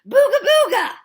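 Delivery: scream. A narrator's reading. Batter screaming in the pitcher's face, high and loud. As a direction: scream it right in his face to spook him. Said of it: "I screamed in his face".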